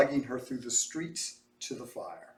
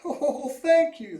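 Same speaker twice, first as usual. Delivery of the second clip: high, slow tearful gratitude; tearful thanks; 13 semitones higher, 6 dB louder, slower than usual. A narrator's reading. Mother thanks Mose tearfully, high slow voice. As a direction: sob out the thanks. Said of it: "the woman cried".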